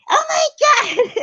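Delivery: huge high yelled outburst; shrieked oh my God at the cooler; mock angry shout. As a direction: scream it like you've lost it. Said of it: "So I got all mad".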